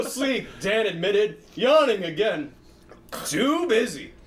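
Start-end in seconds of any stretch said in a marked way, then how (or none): none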